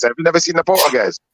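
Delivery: normal talking voice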